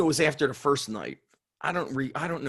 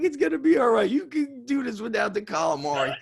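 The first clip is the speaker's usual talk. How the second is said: high mock reassurance, half laughing; pep-talk a friend over lost calamari, much higher; hammed-up comforting; 9 semitones higher, faster than usual. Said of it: "He's like".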